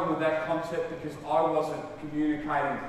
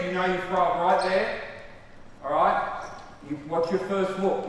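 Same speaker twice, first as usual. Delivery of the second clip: ordinary delivery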